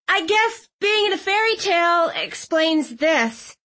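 high, voiced young heroine; Clove's voice. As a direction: lift the voice high for her.

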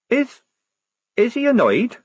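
high, halting, nervous question; hesitate, then ask in a fearful high voice; quavering. A narrator's reading. Girl soldier dreads the prince's anger, quavering. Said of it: "Polly quavered".

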